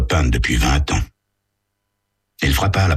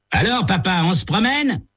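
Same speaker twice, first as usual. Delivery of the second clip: higher, jeering call to the other driver; teen heckling another driver, higher mocking voice; mocking taunt. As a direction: taunt the old man out the window. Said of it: "cria Tommy".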